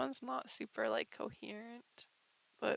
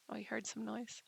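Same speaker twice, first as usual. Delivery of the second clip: ordinary delivery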